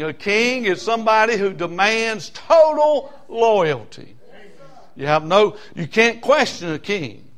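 high-pitched, driving pulpit delivery; insistent sermon cadence thrown out to the congregation; fired-up preaching. Real, not acted. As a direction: preach hard, building to a loud peak. Preaching at full force.

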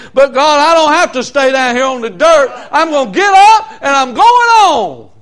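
high, loud preaching build; fired-up preaching. Acted. Preacher telling the church he will get up and go on.

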